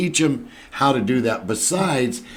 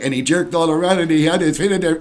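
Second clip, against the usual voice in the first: mock graphic retelling; 6 semitones higher, 4 dB louder, faster than usual. Mocking graphic retelling of death.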